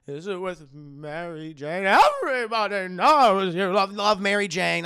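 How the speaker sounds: loud, slow doting aunt; Aunt May voice. Retold or imitated. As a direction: boom it out like a nosy aunt. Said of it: "That's my Aunt May voice".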